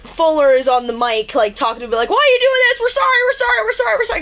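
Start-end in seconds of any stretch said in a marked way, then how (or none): 2.1-4.2 high, desperate begging; panicked pleading. Acted. Fuller begging Rusty Nail over the mic, high desperate pleading.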